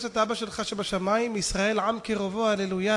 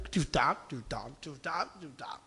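low, soft muttering; hushed, low echo of the name; muttered repetition; 8 semitones lower, 9 dB quieter than usual. Retold or imitated. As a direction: repeat it under your breath. Same name repeated under breath.

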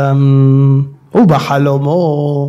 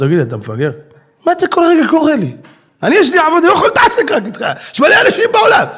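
much higher, fed-up nagging; exasperated scolding; 11 semitones higher than usual. Acted. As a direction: scold the fool again.

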